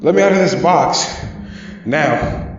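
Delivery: high, loud, terrified yell; scared shout. A narrator's reading. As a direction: scream to be let out. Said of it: "I shouted at the top of my lungs".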